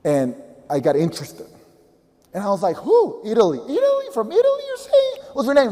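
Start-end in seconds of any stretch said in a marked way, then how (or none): 2.8-5.7 disbelief. Retold or imitated. Stunned by message from Italy.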